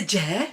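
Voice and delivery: "Je" short for yes. Shown as a demonstration. Aunt's "Je" meaning yes. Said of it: "meaning yes".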